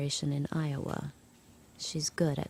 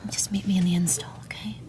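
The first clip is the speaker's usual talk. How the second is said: quick voiceless whisper; 3 semitones higher than usual. Acted. Narrator answering Moira in secret, whispered. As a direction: hush it so the Aunts can't hear. Secret talk after lights out.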